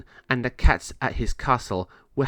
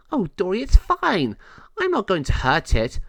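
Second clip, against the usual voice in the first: high, louder defensive kitten; defensive cat voice; 9 semitones higher, 4 dB louder than usual. Acted. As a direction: brush it off, high. Moe replies to Dory.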